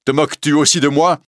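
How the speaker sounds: high, loud, fast outburst; angry cry. A narrator's reading. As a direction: snap at the mocking prisoner. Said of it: "s'écrie, rouge de colère". (cri de colère, aigu, fort et rapide)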